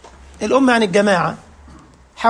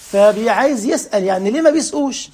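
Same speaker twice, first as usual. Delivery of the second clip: usual speaking voice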